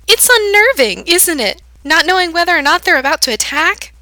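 high, loud, gleeful taunt; taunting girl. A narrator's reading. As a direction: relish his fear out loud. Manic girl taunts Gerard.

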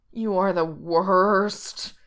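slow drawn-out groan of complaint; slow moaning complaint. A narrator's reading. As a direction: moan it out, soaked and defeated. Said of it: "Reese moans".

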